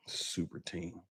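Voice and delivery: very quiet, low scornful mutter; barely audible, fed up; pissed off. Real, not acted. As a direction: mutter it to yourself in disgust. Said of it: "Pissed me off".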